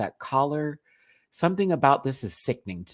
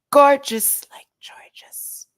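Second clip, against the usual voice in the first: whisper; 14 semitones higher, 11 dB louder, much slower than usual. Acted. Whispered pun on name.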